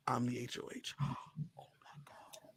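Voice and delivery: acted whisper with a gasp; whispered reveal. Houseguest reveals secret power to ally, stunned whispering. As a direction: breathe out the secret, then gasp in hushed shock.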